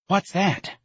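high, wary mother voice; distrustful high-voiced query; suspicious. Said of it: "Missus Riley asked suspiciously".

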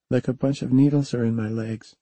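put-on young girl puzzled by her legs; lightly voiced little girl. A narrator's reading. Jamie describing pins and needles.

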